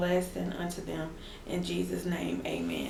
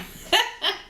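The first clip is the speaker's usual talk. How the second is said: high laugh; 13 semitones higher than usual. Real, not acted. Laughs after scolding viewers.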